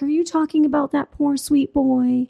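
mock-syrupy fondness; super-sweet women. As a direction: gush sweetly in a put-on voice. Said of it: "just being super sweet about stuff".

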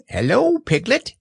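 very high squeak; excited baby squeak; squeaky Roo. Acted. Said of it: "he squeaked".

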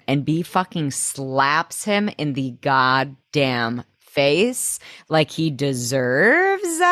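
slow, savoring each word; relished emphasis. Real, not acted. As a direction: drag it out with satisfaction. Relishing the slap.

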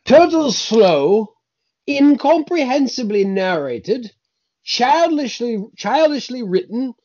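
slow, high-pitched, fussy British reading; fastidious British accent. Acted. Co-host reading Martha's scathing review, fastidious British accent. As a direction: pronounce each word with fastidious British disdain. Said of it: "in a very fastidious British accent".

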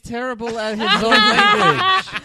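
high, loud, laughing dig; cracking up mid-insult; laughing jab. Real, not acted. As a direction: laugh the punchline out. Joking jab at actor.

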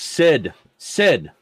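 high, loud yell for a friend; calling out, yelling. Acted. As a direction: yell the name urgently. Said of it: "calling out and yells".

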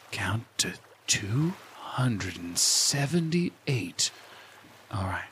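very slow, soft, low counting instruction; hushed and unhurried, lulling the listener; guided meditation. Acted. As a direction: stretch it out softly like a meditation guide.

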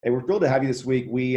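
ordinary talk

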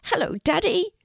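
high-pitched happy greeting; excited little dragon. A narrator's reading. Norman greets his daddy, excited little dragon. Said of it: "very excited to see him".

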